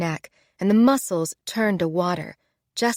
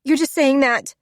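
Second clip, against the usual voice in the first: higher and louder, whiny and miserable; miserably; 7 semitones higher, 6 dB louder than usual. Acted. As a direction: whine it in despair. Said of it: "she said miserably".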